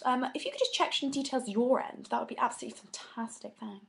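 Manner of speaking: fast, polite phone voice; customer service voice. Shown as a demonstration. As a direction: sweetly ask for help on the phone. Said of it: "my customer service phone voice".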